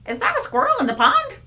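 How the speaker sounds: amazed onlooker; surprised. Acted. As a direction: gasp it out. Character surprised.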